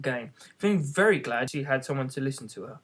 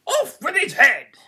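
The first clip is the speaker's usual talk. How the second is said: much higher, louder, slow command; furious royal order; 13 semitones higher, 7 dB louder, slower than usual. A narrator's reading. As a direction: bark it out like an enraged queen. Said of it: "only one way of settling".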